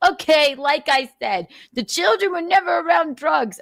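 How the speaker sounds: high mocking voice, imitating his excuse; high sneering imitation of an excuse; mocking Nick. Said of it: "Okay, what?".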